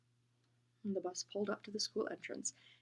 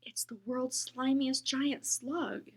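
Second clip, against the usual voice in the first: slow dramatic thought; 5 semitones higher, much slower than usual. Acted.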